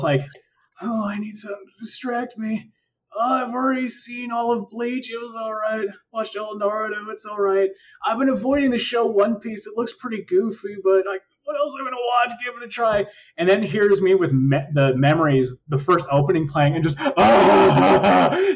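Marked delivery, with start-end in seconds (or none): 0.8-13.1 small, high, listless voice; mock depressed. Depressed after a breakup picking a show, mock moping. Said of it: "I was depressed". 17.2-18.5 long, high fake sob; drawn-out hammed-up crying "ah"; mock bawling wail. First opening plays after breakup, mock bawling. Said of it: "just tears running down my face".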